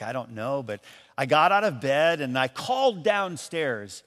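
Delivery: usual speaking voice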